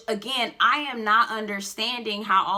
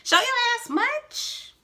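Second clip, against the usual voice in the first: sassy mock; slower than usual. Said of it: "But I'm like".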